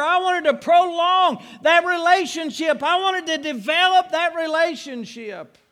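high, loud, driving preaching; fervent preacher pouring it out; preaching at full force. Acted. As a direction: build it up and hammer it home.

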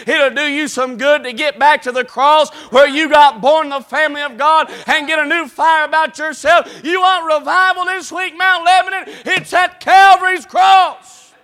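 loud, rising preacher's call to revival; preaching at full force. Acted. Preacher urging Mount Lebanon church to revival, shouting.

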